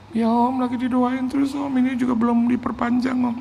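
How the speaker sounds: high, pleading mock-cry; imitated tearful whine. Pastor confesses lease not extended, mock tearful whine. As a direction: whimper the bad news up high. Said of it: "kenapa dia harus nangis gitu loh".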